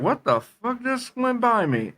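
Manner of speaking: high, startled disbelief; imitating a baffled bystander; mock bewildered worker. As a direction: squeak it like a stunned office drone. Bewildered office worker reacting.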